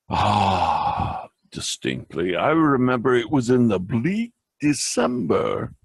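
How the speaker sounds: dramatic poem recitation. Acted. Vlogger reciting The Raven to his viewers as a gift, dramatic poem reading. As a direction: read Poe aloud as a performance, savouring each line.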